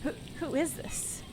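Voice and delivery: high, bewildered man on the phone; confused man voice. Acted. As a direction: sound thrown and baffled.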